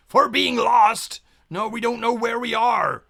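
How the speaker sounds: pirate captain yelling at lost crew; loud bellow. Acted.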